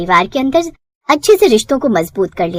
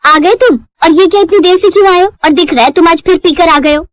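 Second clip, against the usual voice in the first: nagging wife; 5 semitones higher than usual. Acted. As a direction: tell off the late husband. Wife scolding drunk husband.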